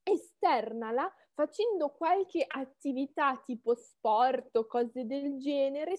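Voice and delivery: ordinary talk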